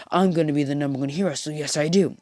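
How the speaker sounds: fast, rushed bragging; rapid boast. Retold or imitated. Bakugo boasting to Deku about being number one, fast. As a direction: blurt the boast out quickly. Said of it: "Bakugo's like".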